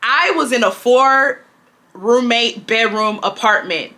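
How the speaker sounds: slow, deliberate emphasis on "four roommate bedroom apartment"; measured, pointed delivery of "I was in a four"; emphatic. Real, not acted. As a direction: spell it out slowly, leaning on "four". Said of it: "let me emphasize this".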